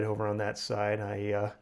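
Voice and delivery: ordinary talk, nothing marked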